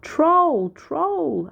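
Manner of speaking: very high, loud alarm call; shout. A narrator's reading. Shepherd girl fake-alarming the town, shouting. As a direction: shout it at the top of her voice. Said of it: "this time she shouted".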